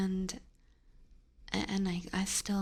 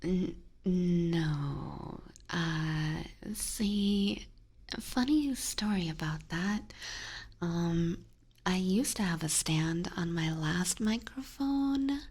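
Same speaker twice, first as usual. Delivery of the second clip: ASMR whisper. Acted. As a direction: whisper close to the mic.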